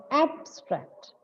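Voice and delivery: "abstract" with AB punched, STRACT lighter; stress on first syllable. Shown as a demonstration. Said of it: "the stress is on the first syllable".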